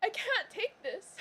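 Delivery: high, soft, slow whimper; overwhelmed, near tears. Real, not acted. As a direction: draw it out softly, choked up. Overwhelmed by the sad ending.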